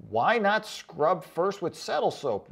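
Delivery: usual speaking voice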